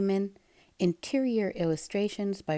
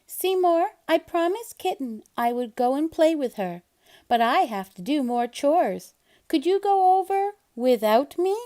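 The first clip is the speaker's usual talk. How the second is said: much higher, louder sweet-talk; Splat coaxing; 5 semitones higher, 5 dB louder than usual. Acted. Splat persuading Seymour to visit Kitten, coaxing. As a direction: coax him out the door.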